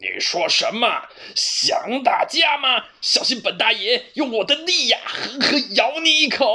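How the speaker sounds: a tomcat bluffing to sound fierce; bluffing cat. A narrator's reading. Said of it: "花猫虚张声势地说". (虚张声势的花猫，高声慢吞吞地逞强)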